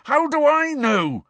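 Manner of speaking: loud, high, drawn-out angry bellow. A narrator's reading. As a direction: bellow it in exasperation. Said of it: "bellowed Harry".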